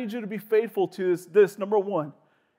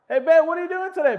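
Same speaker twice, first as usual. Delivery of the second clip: wife asking; 6 semitones higher, 4 dB louder, faster than usual. Retold or imitated. Wife asks again, year five.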